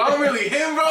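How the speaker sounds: cocky, laughing boast to himself; laughing brag. Retold or imitated. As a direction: crow about it through a laugh.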